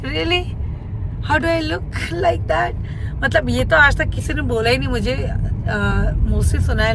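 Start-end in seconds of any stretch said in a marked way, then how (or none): 0.0-2.7 higher, slow, laughing through it; amazed disbelief. Retold or imitated. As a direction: play back your own startled reply with a laugh. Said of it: "I'm like". (हैरानी से हँसते हुए)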